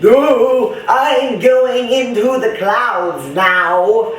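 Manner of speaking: put-on high, slow, loopy Scandinavian accent; drawn-out singsong Scandinavian accent; mock Scandinavian accent. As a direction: imitate a crazed Scandinavian woman. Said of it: "Sound like some Scandinavian chick on crack".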